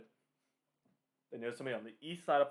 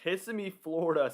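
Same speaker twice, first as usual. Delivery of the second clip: surprised exclaim; 6 semitones higher, 6 dB louder than usual. Real, not acted. Astonished at forecast inland strength.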